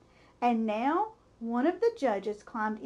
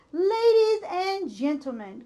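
booming announcer over a loudspeaker; formal announcer voice over the fair speakers; loudspeaker announcement; 5 semitones higher, 4 dB louder than usual. A narrator's reading. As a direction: address the fairground crowd like a PA. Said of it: "continued the loudspeaker".

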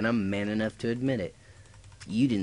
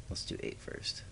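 whispered, much lower and softer aside; quiet aside to yourself; muttered to self; 13 semitones lower, 10 dB quieter than usual. Real, not acted. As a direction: mutter the plan under your breath. Muttering to self while building.

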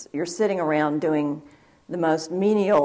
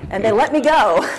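laughing through the words; can't keep a laugh out of it; 7 semitones higher, 5 dB louder than usual. Real, not acted. Telling the interviewer how she got away, laughing. Laughing at her own story.

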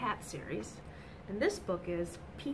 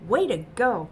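loud cheerful call; 3 semitones higher, 13 dB louder than usual. Acted. Pete congratulating his team after the win, loud call. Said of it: "calls Pete".

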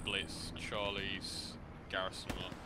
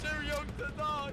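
high hammed-up wail; man down shout. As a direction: wail it like a dying soldier. Dying soldier wail repeated.

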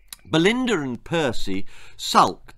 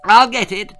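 high, loud eager call; a boy calling out as the doorbell rings, loud and high; shouting; 9 semitones higher, 10 dB louder than usual. Acted. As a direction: yell it as you leap from the table. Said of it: "shouted Percy".

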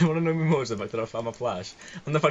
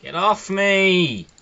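loud, higher outburst under attack; panicked yell; 4 semitones higher, 8 dB louder, slower than usual. Real, not acted. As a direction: yell at the pursuer in alarm. Chased by enemy in game.

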